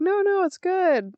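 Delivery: high-pitched imitation of hasty reassurance; high, insistent backpedal; mock reassurance. Quoting people backtracking after her reaction.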